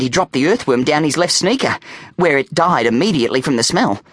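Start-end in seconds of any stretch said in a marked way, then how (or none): none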